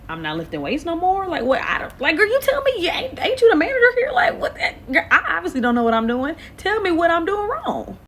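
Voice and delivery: high, loud exasperated pleading; exasperated plea. Retold or imitated. Begging the gym manager for answers, high exasperated plea. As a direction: throw up your hands, high and loud.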